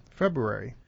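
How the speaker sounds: "February" with a light R, FEB-ru-ary; slight first R. Shown as a demonstration. Giving own habit, "February" with a light first R. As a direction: slip a hint of R into "February". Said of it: "slip a little thought of an R in".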